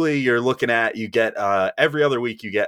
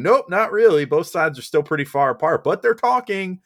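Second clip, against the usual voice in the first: high-pitched, sing-song mock reporter; hammed-up high voice for a pointless announcement; mock breezy update; 8 semitones higher, 4 dB louder than usual. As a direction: parody an empty news update, pitched way up. Mock exchange parodying the reporters' non-update.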